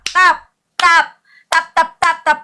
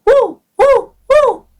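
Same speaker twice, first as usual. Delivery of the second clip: short, high "woo" whoops; high cheering whoops; 7 semitones higher, much slower than usual. Real, not acted. Streamer cheering viewers on to power tap, whooping. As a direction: whoop three times, high and separate.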